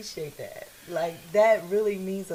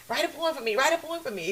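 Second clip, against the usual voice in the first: higher-pitched repeated mock begging; imitating own pestering; 5 semitones higher, faster than usual. Friend recalling how she asks for a poem, mock pestering. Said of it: "me always being like".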